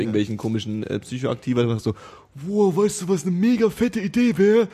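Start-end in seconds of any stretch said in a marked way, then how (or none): 2.3-4.7 stoned excited voice. Retold or imitated. Stoned person having an idea.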